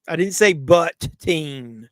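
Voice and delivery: slowed down, emphatic denial; slow protest. Real, not acted. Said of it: "It's my southern drawl".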